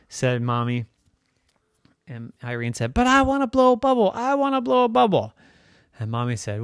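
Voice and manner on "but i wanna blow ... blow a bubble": put-on high, fast little-kid whine; pleading child. Irene begging Mommy to let her blow a bubble, insistent.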